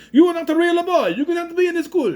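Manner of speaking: high, loud mock telling-off; mock schoolmaster. Imitating the man kicking Pinocchio out of school, high mock scolding. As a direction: throw him out in a high put-on voice. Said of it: "by the guy who was like".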